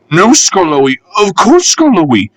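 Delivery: timid coaches quivering their promise; meek quivering. Acted. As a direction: grovel before the old engine. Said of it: "quivered the coaches meekly".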